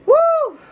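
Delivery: sudden yelped burp; loud high whoop burp. Real, not acted. Big burp.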